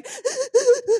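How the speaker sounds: loud, high mock wailing sobs; hammed-up bawling, breaking into laughter; imitated loud crying. Woman acting out her happy tears to host, mock wailing. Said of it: "tears, like, loud, like".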